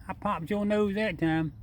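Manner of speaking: high, slow coo to the animal; cooing pet talk. Real, not acted. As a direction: coo it sweetly to the raccoon. Repeats it to the raccoon.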